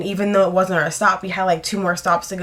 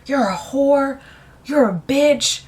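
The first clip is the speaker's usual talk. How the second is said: put-on drunk heckler insults; 5 semitones higher, slower than usual. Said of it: "he starts going in".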